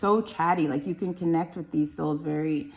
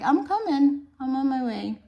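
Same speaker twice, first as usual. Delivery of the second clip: higher, calling ahead to announce yourself; a hint of a baby on the way, pitched up; lightly voiced arriving soul; 8 semitones higher, 4 dB louder than usual. Reader speaking as a baby on its journey, lightly voiced. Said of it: "This is like".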